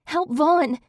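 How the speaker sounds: voice cracking. A narrator's reading. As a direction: cry out, voice breaking. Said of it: "my voice cracking on the words".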